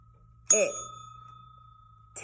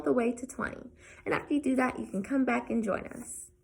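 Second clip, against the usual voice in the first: hushed gentle teacher voice, softer; soft online lesson; 3 semitones higher, 6 dB quieter than usual. Acted. Teacher greeting students at home, hushed and gentle. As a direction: talk softly to the kids at home.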